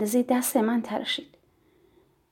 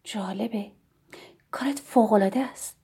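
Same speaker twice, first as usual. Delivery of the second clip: soft, gentle praise with some breath in it; warm and calm; 6 dB quieter than usual. A narrator's reading. Visitor praising the shopkeeper's work, warm and calm. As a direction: speak softly and calmly, warmly approving. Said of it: "با صدایی گرم و آرام گفت".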